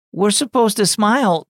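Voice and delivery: put-on a wistful child asking; young girl. Girl explains to aunt.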